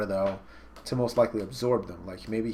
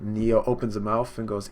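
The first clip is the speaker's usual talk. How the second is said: usual speaking voice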